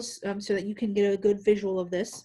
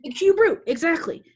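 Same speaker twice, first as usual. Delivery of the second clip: high, loud "The cube root" in praise; excited; 7 semitones higher, 7 dB louder than usual. Real, not acted. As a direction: cheer the right answer. Confirms student's answer.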